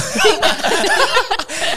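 big high laugh bursting out; loud burst of laughter. Real, not acted. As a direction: crack up loudly. Friend's absurd question recalled.

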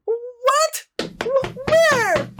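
very high, startled squeak; startled bird. Acted. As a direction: whirl around in surprise. Bird turns around alarmed.

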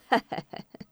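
soft, voiceless snicker; villain's chuckle. Acted. Villain finishes taunting a son about his captive mother, sly chuckle. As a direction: snicker quietly after the taunt.

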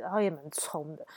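ordinary delivery

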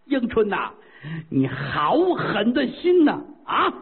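high, drawn-out dying cry at his daughter; dying father's accusation. Acted. Dying father cries out at his daughter, drawn-out and high. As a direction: wail it as the poison takes hold.

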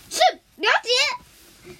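put-on slow, loud, high childish yes-sir; a kid promising eagerly to obey; 乖巧答应. 绿豆冰答应博士. (高声拖长、乖乖答应的童声)

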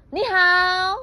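high, loud, startling hello; sudden shout. Acted. Bear dressed as a ghost startling rabbits, sudden shout. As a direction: jump out shouting to scare. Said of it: "突然跳出来大叫".

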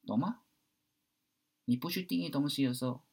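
ordinary delivery

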